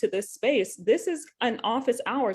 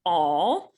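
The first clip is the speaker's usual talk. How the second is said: all said alone, punched louder; stress on ALL; 3 semitones lower, 4 dB louder than usual. Shown as a demonstration. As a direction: lean hard on the single word all. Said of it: "emphasis on the all".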